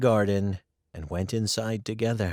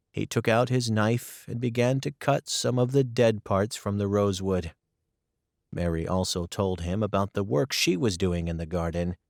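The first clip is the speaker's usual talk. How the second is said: ordinary delivery, nothing marked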